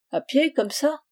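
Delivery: high, rising check on what was heard; surprised question. Acted. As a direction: ask "À pied" with pitch lifted. Husband questions the stranger in surprise.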